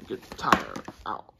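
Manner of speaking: ordinary delivery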